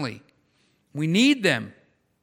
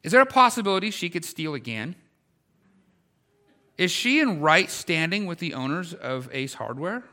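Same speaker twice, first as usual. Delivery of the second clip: ordinary talk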